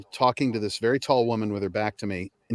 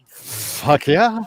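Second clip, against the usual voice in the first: eager yes; 7 semitones higher, 6 dB louder than usual. Retold or imitated. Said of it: "I was like".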